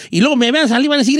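usual speaking voice

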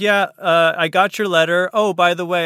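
lightly voiced father shrugging off big news; casual dad reading his reply to her letter; nonchalant dad. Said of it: "He's like".

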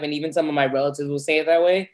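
ordinary talk, nothing marked

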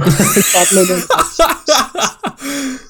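high burst of laughter after the quote. Real, not acted. Laughing at his mum's put-down, high burst of laughter.

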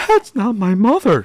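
high-pitched, exaggerated shocked reaction; hammed-up horrified realization; mock shock. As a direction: act scandalized, pitched right up. Acts out Rachel's shocked reaction.

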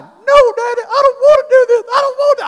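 put-on high, loud sobbing scream of a little boy; crying child screaming. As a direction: beg through tears like a frightened son. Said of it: "screaming, crying".

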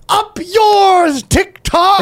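high mocking jeer; high taunting voice. Taunting TikTok.

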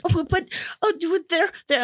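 high, flustered nervous owner; nervous dog owner. Retold or imitated. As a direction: stammer like a worried owner. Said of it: "they're like".

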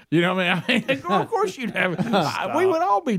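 laughing high and giddy; can't stop laughing through it. Real, not acted. Laughing at the joke.

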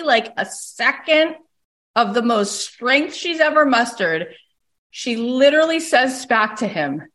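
usual speaking voice

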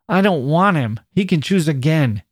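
bitter hopelessness. A narrator's reading. Annie giving up the man, bitter hopelessness. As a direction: reject him with a touch of hopelessness. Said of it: "with the same rather bitter hopelessness".

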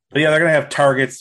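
loud and quick, stressing gonna; emphatic. Real, not acted. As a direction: punch the list out fast. Emphatic list of features.

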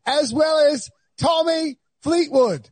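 high-pitched British TV host introduction; mock British announcer, high and drawn out on the names; Trevor Noah. Host imitating Trevor Noah naming guests, mock British announcer. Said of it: "He's like".

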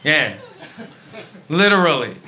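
normal talking voice

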